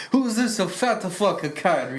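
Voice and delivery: put-on laughing, slow Russian accent; Russian-accented insult through laughter; mock Russian accent. Cohost mocking Putin in a Russian accent, laughing. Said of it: "you do a good Russian accent".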